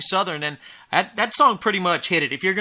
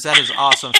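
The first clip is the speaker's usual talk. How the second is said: ordinary talk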